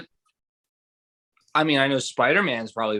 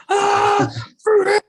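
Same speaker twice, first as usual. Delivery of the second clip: very high, loud panicked cry; distressed scream; 6 dB louder than usual. Pretend. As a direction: shriek in mock distress. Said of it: "in serious distress".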